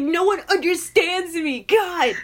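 put-on whiny high teen voice, drawn out; angsty teenager. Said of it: "I just sound so angsty".

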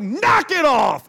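high, loud, drawn-out holler; acted fed-up dad shout. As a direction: bellow it to stop the whole restaurant. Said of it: "And like, loud, like, the whole restaurant stops".

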